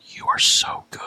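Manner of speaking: hushed, whispered praise; awed whisper. Retold or imitated. Ringside fan to the wrestler, awed whisper. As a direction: whisper it as a private aside.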